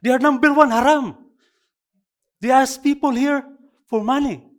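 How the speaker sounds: furious rant. Retold or imitated. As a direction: rant at him in anger. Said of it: "Galit na galit siya".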